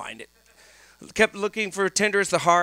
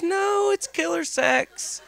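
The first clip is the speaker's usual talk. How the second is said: put-on teen nephew voice; 10 semitones higher than usual. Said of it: "He goes".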